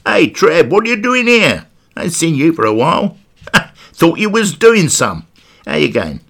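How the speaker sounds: chirpy Essex geezer greeting a mate; Essex accent. Retold or imitated. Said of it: "with an Essex accent".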